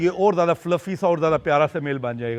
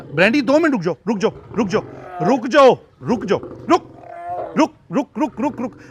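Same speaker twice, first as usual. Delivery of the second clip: urgent stop; 5 semitones higher than usual. Real, not acted. Owner urges Brandy to wait while checking kittens, urgent raised calls. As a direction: hold her back with quick calls.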